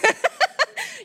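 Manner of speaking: high real laugh. Real, not acted. Speaker laughing at her own point, real laugh. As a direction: burst out laughing.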